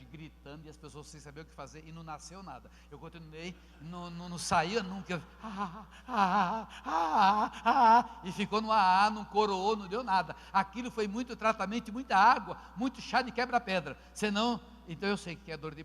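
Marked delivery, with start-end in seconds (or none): 6.1-9.8 pain cries. Retold or imitated. Reenacting kidney stone pain cries.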